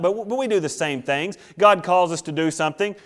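ordinary talk, nothing marked

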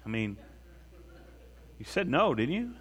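softly voiced, tentative questioning; imagined Peter. Peter checks with Jesus, lightly acted soft questioning. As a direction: quietly check that the answer was no. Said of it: "I always picture Peter saying".

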